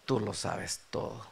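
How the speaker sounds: low, soft, subdued confession; understated sorrow, voice dropped low; retold tearful reply. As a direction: answer quietly as if weeping, without sobs. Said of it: "Pedro comienza a llorar".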